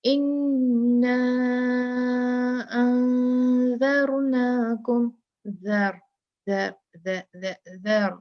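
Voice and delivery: slow chanted tilawat; Quran recitation. Acted. Teacher reciting a verse for her students, chanted. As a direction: recite slowly with ذ between the teeth.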